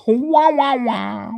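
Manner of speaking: high wah-wahs falling away; silly wah-wah noise. Pretend. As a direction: play a high comic wah-wah. Repeats the silly childhood noise.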